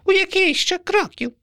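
very high little sparrow; puzzled tiny bird squeak; startled sparrow question. Acted. Sparrow character voice.

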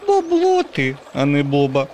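very high, mocking girlish taunt; smiling girl. A narrator's reading. Little U mocking Bob's name with a smile, very high. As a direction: tease him with a smile in your voice. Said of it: "усміхнулась дівчинка".

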